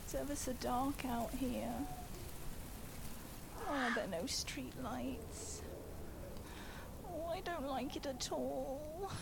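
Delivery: soft frightened murmur; creeping nervously through the dark; scared. Acted. Alone outside in the dark.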